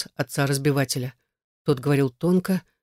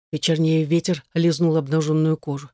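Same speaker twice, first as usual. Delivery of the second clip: usual speaking voice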